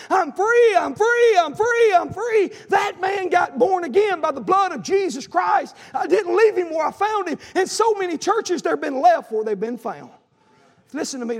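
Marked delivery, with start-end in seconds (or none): 0.1-2.5 high, loud shout of release; re-enacted convert's jubilant shouting; imitated joyful scream. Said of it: "he starts screaming". 2.7-10.1 high, loud revival proclamation; triumphant preacher in full cry; fired-up preaching. Acted.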